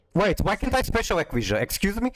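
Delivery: sharp rise in disbelief; surprised. Real, not acted. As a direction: caught off guard, voice jumps up. Special summon suddenly fails.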